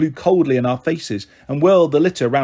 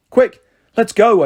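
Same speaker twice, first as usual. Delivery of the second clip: hurried urgent plea, higher and louder; 7 semitones higher, 6 dB louder, slower than usual. A narrator's reading. Girl urging her mum to flee the gates, urgent. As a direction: push to leave now. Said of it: "I said".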